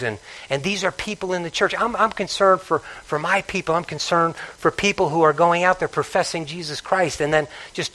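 low, hushed, heavy concern; grave and quiet. Real, not acted. Preacher confessing concern to his congregation, hushed. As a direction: speak softly as if troubled.

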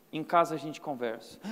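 a hint of a stern dad warning; stern father quote. Preacher quoting his father's quiet warning to his son. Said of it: "meu pai virava e falava assim".

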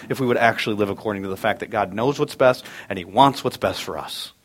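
usual speaking voice

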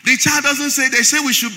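loud, building to a shout; full-force preaching. Acted. Pastor rousing his congregation to a shouted climax. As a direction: whip the church up, shouting.

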